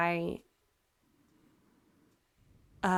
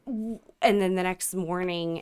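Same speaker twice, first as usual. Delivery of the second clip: ordinary delivery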